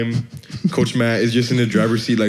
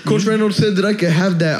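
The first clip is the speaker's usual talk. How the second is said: coach voice; 9 semitones higher than usual. Retold or imitated. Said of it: "he was like".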